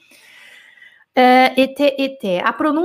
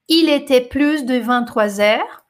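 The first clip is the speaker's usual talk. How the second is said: slow French reading; slower than usual. Shown as a demonstration. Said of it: "reading the French line".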